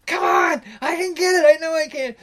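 high, loud imitated shout; hammed-up stubborn skipper pleading; insistent shout. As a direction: skipper yelling back that he can make it. Skipper insists he'll grab buoy.

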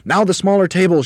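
high, loud excited command; Mugglewump shouting. A narrator's reading. Monkey leader to helpers, shouting. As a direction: shout the next order. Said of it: "shouted Mugglewump".

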